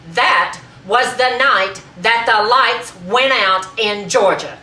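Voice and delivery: loud, high, ringing proclamation; triumphant, full-force declamation; grand climax. Acted. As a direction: hammer home the final boast. Punchline of the acted tirade.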